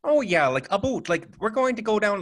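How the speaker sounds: high-pitched cartoon Canadian accent. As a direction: put on a hammed-up Canadian accent. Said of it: "everyone thinks that the accent is like".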